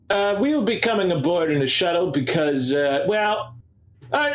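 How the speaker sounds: a hint of a snooty Necromundan aristocrat giving orders; pompous Necromundan noble. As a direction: Necromundan noble talking down to the station officer. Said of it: "I hate Necromundan accent".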